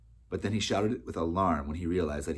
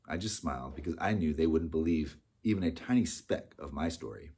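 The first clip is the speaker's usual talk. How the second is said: ordinary delivery, nothing marked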